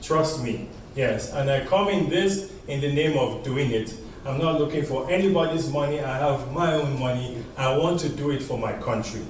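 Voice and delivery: ordinary talk, nothing marked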